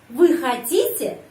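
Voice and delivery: хотите lifted on the stressed middle syllable; questioning pitch peak on хотите; question intonation. Shown as a demonstration. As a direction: ask by raising the middle of хотите. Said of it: "saya menyusun kalimat tanya".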